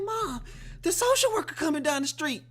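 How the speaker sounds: high, loud urgent warning; panicked daughter. Retold or imitated. Daughter warning her mom about the social worker, high and urgent. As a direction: rush the news like the danger's coming. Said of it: "She like".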